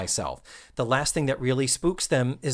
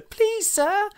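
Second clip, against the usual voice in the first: put-on much higher, timid child plea; little girl voice. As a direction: beg sweetly like a fairy-tale child. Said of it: "And she's like".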